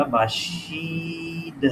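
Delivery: باشید with a long-held "shee"; drawn out. Shown as a demonstration. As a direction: stretch the second syllable of باشید. Stretches the answer word.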